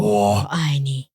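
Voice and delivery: deep gruff Geralt-style 我爱你; Witcher voice. Pretend. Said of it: "你是Witcher吗".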